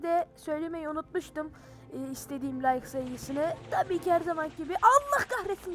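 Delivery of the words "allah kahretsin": startled curse. Real, not acted. Jump scare mid-talk.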